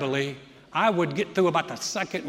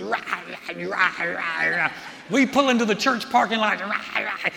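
rah rah rah as imitated bickering; mock argument noise; 4 dB louder than usual. Husband imitating the car fight with his wife, mock bickering noises. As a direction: mimic a couple fighting in gibberish. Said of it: "we'd be going down the highway".

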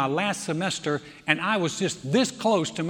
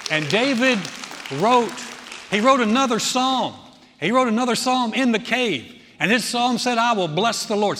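loud, driving sermon delivery; impassioned preaching; 2 semitones higher, 6 dB louder than usual. Acted. Preacher declaring David's faith to the congregation, loud and impassioned. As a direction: preach it out with rising force.

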